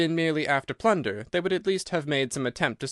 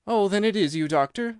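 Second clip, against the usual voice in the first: relieved; 7 semitones higher, 4 dB louder than usual. Acted. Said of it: "with a great heave of relief".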